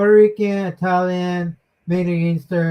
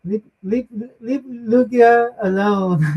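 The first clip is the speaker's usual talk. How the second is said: mock pleading; 5 semitones higher than usual. Listener jokingly defends the teased host, mock pleading. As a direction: plead for your friend as a joke.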